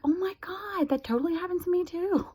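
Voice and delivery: high, bright-eyed gush of agreement. Retold or imitated. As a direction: squeal it like a kindred spirit. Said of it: "And I'm like".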